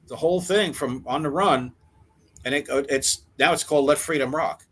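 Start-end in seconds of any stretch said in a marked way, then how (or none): none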